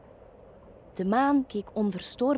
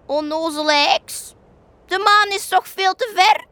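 much higher and louder mocking yell; the oldest rabbit shouts his scorn at the witch; scolding shout; 9 semitones higher, 9 dB louder than usual. A narrator's reading. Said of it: "schreeuwde het oudste konijn".

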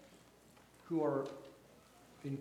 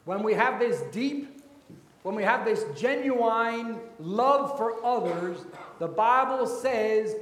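loud, raised pulpit proclamation; preaching at full force; 5 semitones higher, 8 dB louder than usual. Real, not acted. Preacher urging congregation to genuine love for others, full-force preaching. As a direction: proclaim it to the whole congregation, stretching key words.

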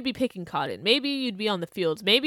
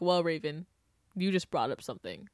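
a low, soft imitation of a viewer; low mock viewer voice; 5 semitones lower, 9 dB quieter than usual. Imagined viewer reply.

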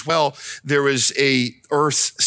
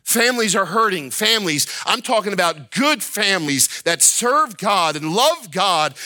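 ordinary delivery

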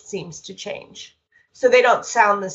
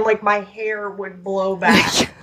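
usual speaking voice

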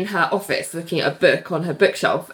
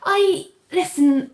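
high, slow, strained brightness; brittle bright Ali; 11 semitones higher, much slower than usual. Retold or imitated. Ali hiding her gloom from her friends, in a high forced voice. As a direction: cover the hurt with a high chirp. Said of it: "And Ali's like".

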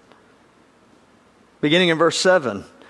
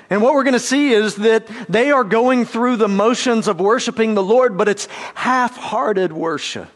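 usual speaking voice

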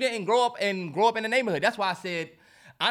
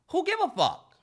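high-pitched incredulous question; exasperated dismissal; 10 semitones higher than usual. Real, not acted. Dismissing the grandfather argument.

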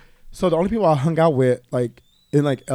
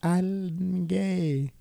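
high, soft and slow, drawn out; retold coy announcement; 5 semitones higher, 5 dB quieter, much slower than usual. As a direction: ease into the news in a small high voice. Sheepishly breaking news to aunt.

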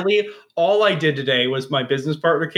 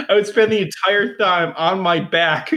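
ordinary talk

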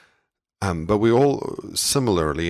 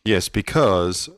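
slow, emphatic authoritative pronouncement; 2 semitones higher, 4 dB louder, much slower than usual. Real, not acted. Podcast host answering a co-host, authoritatively. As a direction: say it with weighty authority, drawn out. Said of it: "I said that very authoritatively".

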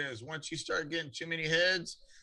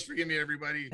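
ordinary delivery

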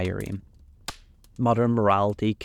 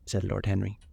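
ordinary delivery

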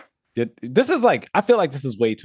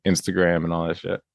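ordinary talk

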